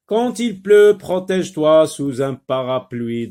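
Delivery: loud, high-pitched classroom reading; projected dictation voice, high start; teacher reading aloud. Shown as a demonstration. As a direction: read it out emphatically to young learners. Teacher rereads the sentence for learners.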